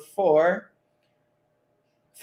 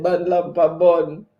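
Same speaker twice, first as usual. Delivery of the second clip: slow patois chant, a little louder; Jamaican patois quote; 5 dB louder than usual. Retold or imitated. Host reads Ricardo's chat in slow Jamaican patois. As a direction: drag out the patois words slowly. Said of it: "And Ricardo said".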